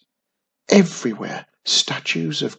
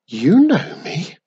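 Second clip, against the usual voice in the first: slow, high-swooping, louder question; surprised; 5 semitones higher, 6 dB louder than usual. A narrator's reading. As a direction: ask in wondering surprise. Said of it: "Wiz sounded surprised".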